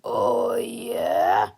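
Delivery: very low, rumbling growl; deep growl. A narrator's reading. Super Mega Tippy growls a challenge at his smaller double. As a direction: growl it so the lake trembles.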